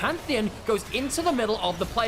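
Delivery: high, urgent esports casting; rising caster hype over a teamfight; live play-by-play. Acted. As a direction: call the action as it happens. Play-by-play of the Baron fight.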